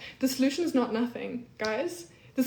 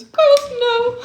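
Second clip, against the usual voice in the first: high, loud shocked yelp; real startled outburst, much higher and louder; shocked cry; 14 semitones higher, 13 dB louder than usual. Real, not acted. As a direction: blurt it out in shock. Reacts in shock to comment.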